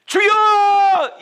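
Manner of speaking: a long, high, drawn-out cry of 주여; long crying shout. Shown as a demonstration. Preacher shows the congregation a loud, long-held cry of 주여. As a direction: cry out to the Lord at full voice, stretched long. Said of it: "이 소리도 내기 쉽지 않습니다".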